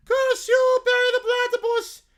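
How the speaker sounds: very high, loud cartoon curse; furious villain shout. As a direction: shriek it at the sky in defeat. Villain's defeat catchphrase.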